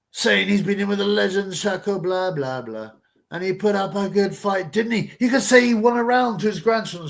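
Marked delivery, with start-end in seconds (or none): none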